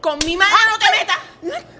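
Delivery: angry shout. Acted. Daughter screaming at the woman who insulted her mother. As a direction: scream it to defend your mother.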